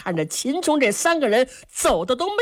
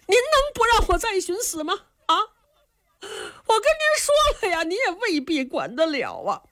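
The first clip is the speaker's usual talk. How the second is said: much higher, wailing plea; crying plea; 11 semitones higher than usual. Acted. Young man pleads tearfully with Qin Qiong. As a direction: beg through tears.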